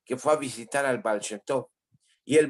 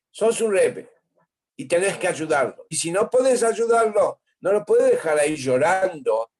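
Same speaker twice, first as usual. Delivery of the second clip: raised, higher indignant protest; indignant demand; 5 semitones higher, 4 dB louder than usual. Acted. Brother confronting the Rebbe about the crying man, indignant and raised. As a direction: push back hard on the Rebbe, pitch climbing.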